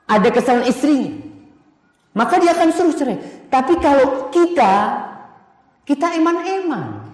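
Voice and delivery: high, loud sermon voice; fervent preacher, high and loud; preaching at full force. Acted. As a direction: drive the point home to the congregation. Preacher raising voice.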